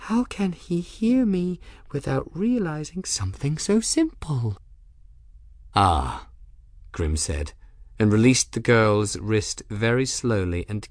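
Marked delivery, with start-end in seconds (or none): 0.1-4.6 put-on high, soft girlish voice; wondering girl. Girl fingering her crystals, puzzled by him, high soft musing. 5.7-6.3 low-pitched man's voice; realizing man. Acted. Grim understands what the girl is, low voice.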